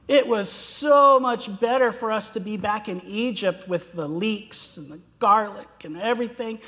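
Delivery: high, whiny mock complaint; grumbling Israelites. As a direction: whine like spoiled wanderers. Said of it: "they complained and grumbled".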